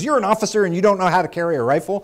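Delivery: high, fast, indignant shout. Retold or imitated. Gunnery sergeant challenges officer who can't port arms, incredulous yell. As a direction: fire it out in disbelief. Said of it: "louder and louder and louder".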